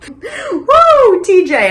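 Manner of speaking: high, loud whoop of self-amusement; exhilarated whoop. Real, not acted. Host hyped after performing the rap, whooping loudly.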